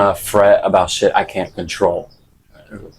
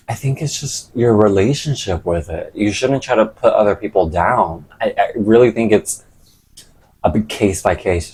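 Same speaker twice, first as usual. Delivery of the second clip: normal talking voice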